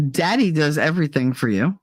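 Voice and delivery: ordinary talk, nothing marked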